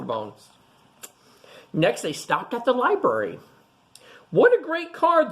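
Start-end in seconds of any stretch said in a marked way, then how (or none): 4.3-5.3 much higher, louder delighted exclamation; delighted praise. Acted. Librarian receiving George's card, delighted high praise. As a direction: gush over the card. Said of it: "the librarian said".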